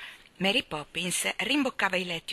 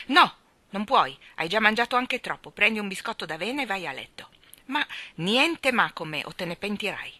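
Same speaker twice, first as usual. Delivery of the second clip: severe; 4 semitones higher, 6 dB louder than usual. A narrator's reading. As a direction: cut him off firmly. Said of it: "lo investì lei severamente".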